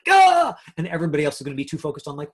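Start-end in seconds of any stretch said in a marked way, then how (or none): 0.1-0.5 sudden high, loud yelp of panic; panicked yelp. Retold or imitated. Actor flubbing a word in audition yelps in panic. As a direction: blurt a startled cry at the flubbed word. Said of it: "You're gonna be like".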